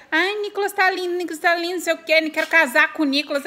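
high, fast swooning fan voice; gushing girl fan. Retold or imitated. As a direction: squeal it like a smitten girl. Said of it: "Aí, ó, meninas, vocês que ficam".

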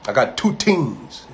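put-on slow hillbilly accent leaning on "two"; hammed-up hillbilly accent in the telling; mock hillbilly accent, slow. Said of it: "That's how he gonna talk".

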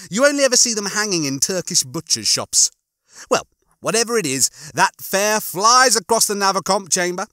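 high-pitched, gleeful description; Rimmer. Acted. Rimmer describes Lister being blown to bits, with relish.

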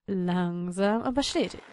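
low, drawn-out mantra; slow and steady. Pretend. Player creeping up the mountain, slow mantra. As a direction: stretch out "Langsam" low.

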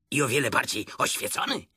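ordinary delivery

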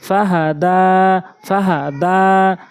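slow ফাহা দা, long vowels held; drawn-out madd. Shown as a demonstration. Teacher applying madd in a word, drawn-out vowels. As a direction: stretch হা and দা in ফাহা দা. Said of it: "প্রয়োগ করে পড়ব".

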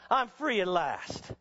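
preaching hard and high, driving the story on; full-force preaching. Acted. Preacher to Bible college students, preaching at full force. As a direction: preach it like the whole room must hear.

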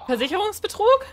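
high, loud disbelieving question; shocked outrage at the dog; incredulous. Real, not acted. Gamer watching Helga fall down the stairs, high incredulous outcry.

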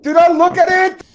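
urgent yelled warning, high pitched; urgent shout. Real, not acted. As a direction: yell it as a panicked warning. Warning friend about monster.

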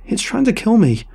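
high, loud panicked yell. Acted. Answering Huck while chased, terrified yell. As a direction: shout it out in terror. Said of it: "I yelled in answer".

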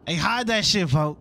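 urgent panic. Pretend. Police coming, must hide drugs.

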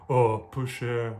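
slow, drawn-out mock pity; sarcastic. Shown as a demonstration. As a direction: drag it out with dry sarcasm. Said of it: "in the sarcastic way".

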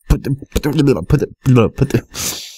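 stumbling over words; stuck repeating the same word; stuttering. Real, not acted. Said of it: "I can't talk".

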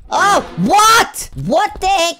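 loud, high-pitched shock; high, startled yelp of disbelief; startled outcry. Real, not acted. Startled by what he sees.

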